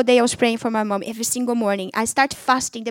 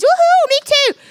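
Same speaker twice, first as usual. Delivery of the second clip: much higher and louder party whoop; whooping sister; 8 dB louder than usual. Retold or imitated. Sister cheering about smoking a spliff, whooping. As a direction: cheer like a friend ready to party.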